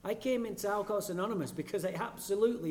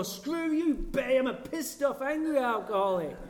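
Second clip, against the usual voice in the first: high, loud mock outburst; louder, hammed-up angry shout; angry retort; 8 semitones higher, 6 dB louder than usual. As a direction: snap back at Betty, hammed-up. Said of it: "I'd stand up and go".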